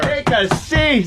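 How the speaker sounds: high, slow sing-song; drawn-out mock politeness; mock high welcome. Host seats caller, high slow mock politeness.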